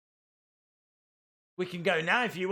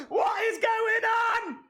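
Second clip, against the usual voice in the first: high, slow shout through laughter; laughing shout; 13 semitones higher, slower than usual. Real, not acted. Fan stunned by the twist, laughing shout. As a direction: laugh it out in disbelief.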